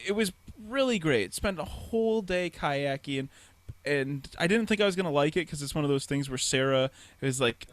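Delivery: ordinary talk, nothing marked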